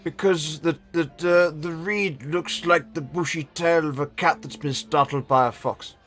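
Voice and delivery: put-on high, slightly slow old man. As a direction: play a doddery old wizard. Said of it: "Generic old man voice one".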